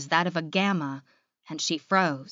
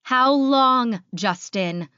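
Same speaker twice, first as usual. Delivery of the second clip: loud, raised, drawn-out "long"; higher, louder outburst, easing on the name; angry yell; 5 semitones higher, 6 dB louder, much slower than usual. A narrator's reading. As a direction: yell the demand at him. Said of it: "she yelled".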